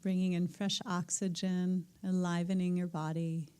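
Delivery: calm meditation teacher settling the room; guided meditation. Acted. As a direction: ease the group gently into stillness. Guides breathing and listening.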